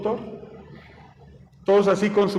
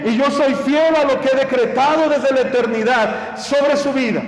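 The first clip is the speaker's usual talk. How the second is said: raised, fervent preacher's voice, a touch higher; preaching; 3 semitones higher than usual. Acted. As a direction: preach it out to the congregation. (predicación fervorosa, con la voz un poco más alta)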